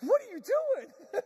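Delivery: high, loud, drawn-out mock shriek; imitated yell, stretched and high; alarmed yelling. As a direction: holler in disbelief at the car ahead. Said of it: "Now Vicky's behind me going".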